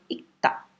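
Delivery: ita em sussurro, sem o r; whispered demo. Shown as a demonstration. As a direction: sussurre ita como exemplo. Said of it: "A pronúncia dele vai ser ita". (sussurro mostrando a pronúncia de ita)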